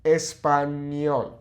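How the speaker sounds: "español" slow, ñ as NY; ñ sound. Shown as a demonstration. Teacher repeating "español" slowly for the ñ. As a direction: stretch "español" so the NY sound is clear. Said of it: "That N Y sound".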